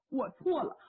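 ordinary delivery, nothing marked

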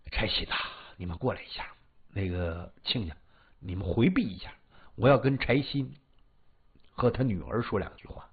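low, hushed elder's voice; weary father-in-law. Acted.

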